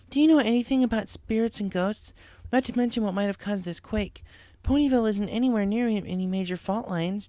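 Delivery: studious pony voice; Twilight voiced. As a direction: ask quickly, worried.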